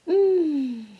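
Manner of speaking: high drawn-out yummy mm; savoring mm. Acted. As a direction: hum with delight at the smell. O smells apple pie.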